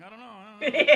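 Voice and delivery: laughing through the words; can't get it out for laughing. Retold or imitated. Telling the crowd about a yelling driver, laughing. Acting confused friend, laughing.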